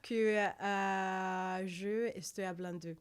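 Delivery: slow Spanish in a French accent. Shown as a demonstration. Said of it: "speak with a French accent".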